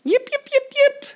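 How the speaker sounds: very high yelping yips; yipping fox. A narrator's reading. Fox yipping as it runs away. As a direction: yip like a fleeing fox. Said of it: "cried the fox".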